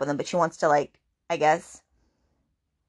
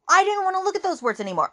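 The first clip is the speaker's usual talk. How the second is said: higher, mildly hurt heroine voice; voicing Tate; 12 semitones higher, faster than usual. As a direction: give her a higher, wounded voice. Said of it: "she goes".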